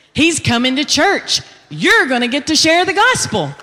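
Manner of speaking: an inmate who can't contain the news; thrilled announcement. Retold or imitated. Inmate's joyful announcement, retold.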